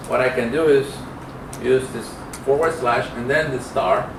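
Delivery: usual speaking voice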